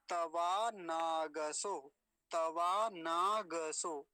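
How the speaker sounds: तवा नागसो very slowly, full ग in नागसो; slow full-g demo. Shown as a demonstration. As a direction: keep the ग whole in नागसो, slowly. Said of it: "गकार पूरा है". (नागसो में पूरा ग, धीरे)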